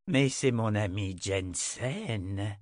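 slow, menacing, mock-friendly; low menacing masculine voice. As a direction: savor the recognition like a predator. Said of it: "plus basse et menaçante avec des accents nettement masculins".

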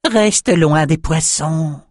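high, slow squeaky voice; high, slow shaman squeak; squeaky advice. A narrator's reading. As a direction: warn him like a odd little creature. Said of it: "lui conseilla-t-il".

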